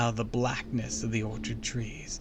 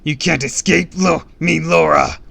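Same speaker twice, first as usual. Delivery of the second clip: high, loud beast voice; monstrous roar; 7 semitones higher, 14 dB louder than usual. A narrator's reading. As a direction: roar the threat after a fleeing enemy. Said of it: "I roared".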